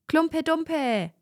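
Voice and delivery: high shouted answer; children shouting. A narrator's reading. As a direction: call it out over the others. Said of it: "schrien andere".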